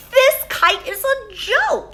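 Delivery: high, loud, fed-up Toad voice; discouraged Toad. Acted. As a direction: complain like a sulky little toad. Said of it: "he said".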